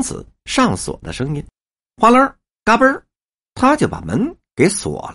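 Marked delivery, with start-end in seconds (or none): 2.0-3.0 lock clack. Retold or imitated. Imitating lock sound.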